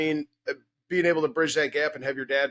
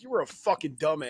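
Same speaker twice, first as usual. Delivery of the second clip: mock self-scolding; 7 semitones higher than usual. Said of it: "I look back and I'm like".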